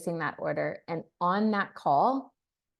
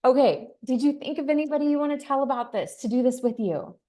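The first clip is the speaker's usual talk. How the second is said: high, fast, bubbly excited voice; pitched-up excited message to a new customer; gushing excitement; 6 semitones higher, faster than usual. Retold or imitated. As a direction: gush like a thrilled friend on the phone. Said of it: "It can be".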